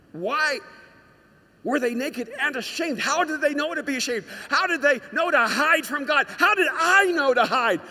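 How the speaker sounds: high questions building to full loudness; impassioned preaching. Real, not acted. Preacher asking how Adam and Eve knew to hide, impassioned. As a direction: press each question harder, peaking on the last.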